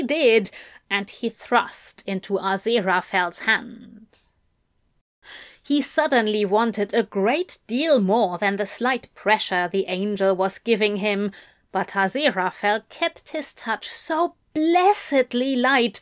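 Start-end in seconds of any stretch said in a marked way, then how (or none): none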